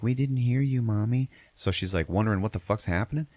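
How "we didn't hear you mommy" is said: put-on higher, mildly childlike protest; a hint of a little boy pleading; lightly voiced kid. Teller voices kid answering his mommy, lightly childlike. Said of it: "And the kid's like".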